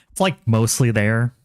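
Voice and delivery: put-on slow Valley girl drawl. Shown as a demonstration. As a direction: lean on like, Valley girl style. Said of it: "Sound like a Valley girl".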